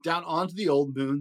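plain reading voice

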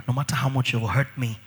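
ordinary talk, nothing marked

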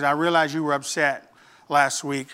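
soft, low teacher's talk; gentle. Retold or imitated. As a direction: break it to him kindly. Teacher gently talks to upset pupil.

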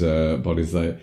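usual speaking voice

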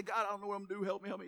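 soft monotone mock pleading; mock helpless prayer. Preacher imitating a believer begging God, mock helpless prayer. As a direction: mumble a helpless plea on one note.

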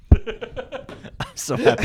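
high, slow, laughing words; cracking up with delight; helpless laughter. Real, not acted. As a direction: squeeze it out through real laughter. Cracking up at friend's joke.

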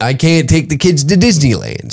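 ordinary talk

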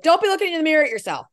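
high-pitched imitation parental reproof; mock scolding. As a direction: scold a vain child, playfully.

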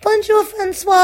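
very high, loud sing-song doll voice; shrieking French doll greeting; creepy doll voice. Pretend. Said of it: "Is that what his voice sounds like?".

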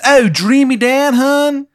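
put-on very high, loud Baltimore accent; fake Maryland accent. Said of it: "he's faking being from Maryland".

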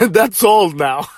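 breaking into a laugh mid-phrase. Real, not acted. Speaker amused by their own aside about an old movie, laughing. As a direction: say it through a burst of laughter.

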